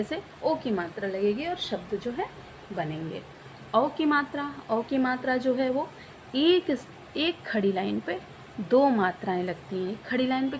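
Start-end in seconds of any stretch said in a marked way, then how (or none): none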